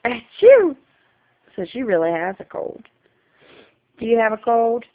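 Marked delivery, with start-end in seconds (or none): 0.0-0.8 loud, high-pitched "achoo!"; fake sneeze. Shown as a demonstration. Mother showing toddler a sneeze, playful fake sneeze. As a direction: do a big pretend sneeze.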